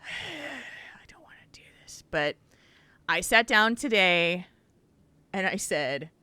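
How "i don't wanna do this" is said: weary whisper after a sigh; reluctant whisper. Retold or imitated. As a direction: whisper it like you dread the chore. Sigh before reluctant quote.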